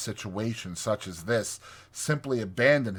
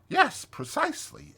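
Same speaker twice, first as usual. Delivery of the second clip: much higher, agreeable interjection. A narrator's reading. Rat answering chattering Toad at intervals, high polite character voice. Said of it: "Rat was saying at intervals".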